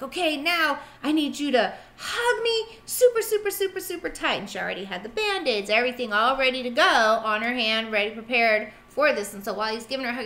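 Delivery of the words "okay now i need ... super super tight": high, sing-song coaxing; coaxing nurse. Retold or imitated. Nurse asking the boy for a tight hug, high and sing-song. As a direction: stretch out the supers to coax a child. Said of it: "she's like".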